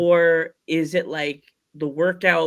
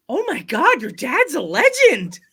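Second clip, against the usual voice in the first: raised, starstruck gush; gushing excitement; 10 semitones higher, 5 dB louder than usual. Retold or imitated. Sister gushing about wrestling legend.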